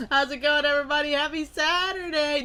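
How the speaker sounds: high, bright stream greeting; excited greeting. Real, not acted. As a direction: greet the chat with energy.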